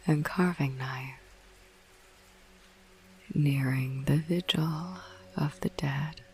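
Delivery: slow, spooky whispered verse reading; hushed, eerie poetry reading; whispered poem recital. Acted. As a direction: savour each line like a ghost story.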